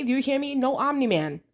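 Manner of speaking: normal talking voice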